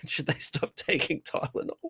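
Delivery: a laugh then a whispered mocking question; giggle, then breathe the question out; snickering at the idea of pregnant men; laughing mockery. Real, not acted.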